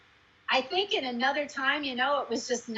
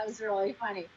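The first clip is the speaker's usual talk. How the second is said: ordinary talk, nothing marked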